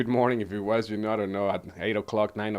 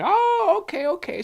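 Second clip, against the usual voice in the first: high, louder squeal of a diner; mock customer voice; 4 dB louder than usual. Server mimicking a diner impressed by his English, high-pitched.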